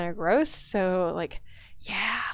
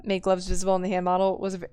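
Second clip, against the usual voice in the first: usual speaking voice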